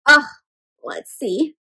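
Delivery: ordinary delivery, nothing marked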